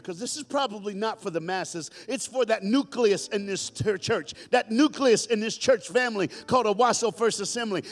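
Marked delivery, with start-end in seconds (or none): 2.1-7.8 loud preaching building to a high shout; full-force preaching. Real, not acted. Preacher sending his church family out, full-force preaching.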